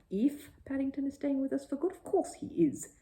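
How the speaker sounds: a mild mother's protest; exclaiming. A narrator's reading. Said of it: "exclaimed Missus Brown".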